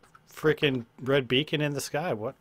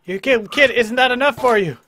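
loud yell; 10 semitones higher, 9 dB louder than usual. Real, not acted. Yelling at attacking mob.

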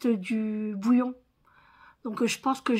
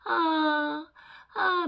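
mild imitation of a patient crying out, higher; imitated cries; 5 semitones higher than usual. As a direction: echo the patient's cry, lightly. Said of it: "il fait". (imitation légère des cris du patient, plus aiguë)

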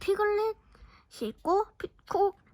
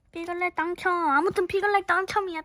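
shrieking jackpot winner; 4 semitones higher, 6 dB louder than usual. Real, not acted. Repeats the Piglet win.

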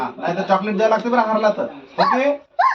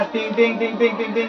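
rhythmic bell-like ting sounds; imitated ting-ting jingle; 5 dB quieter, much faster than usual. Teacher building up to the quiz question, chanted ting-ting.

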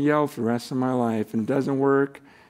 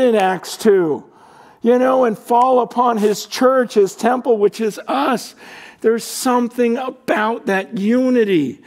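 high, loud preaching at full force; fiery preaching; 10 semitones higher, 5 dB louder than usual. Acted. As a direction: preach it like a rallying cry.

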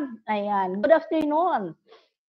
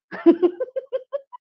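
a burst of high delighted laughter; 11 semitones higher than usual. Real, not acted. Host reading comments on a live stream laughs high and delighted.

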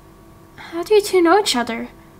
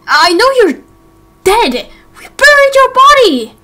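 climbs higher and louder to a shout; rising disbelief; 9 semitones higher, 8 dB louder than usual. Acted. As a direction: build from shock to a cry. Disbelief at dead friend alive.

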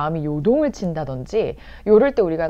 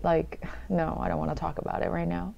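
reluctant refusal; 8 dB quieter, much slower than usual. Acted. Speaker declining to discuss it right now, quiet reluctant refusal. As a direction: quietly beg off the subject.

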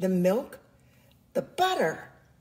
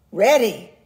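loud eager yell; loud excited ready; shouted; 11 dB louder than usual. A narrator's reading. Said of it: "shouted Jack".

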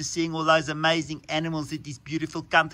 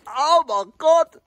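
mock shock; 11 semitones higher, 7 dB louder, slower than usual. Landslide hits the car.

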